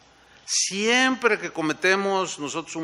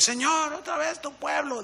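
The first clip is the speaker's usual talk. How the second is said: much higher, exasperated whine; fed-up complaint; 11 semitones higher, 4 dB quieter than usual. Retold or imitated. Moses complaining to the Lord about his people, high and fed up.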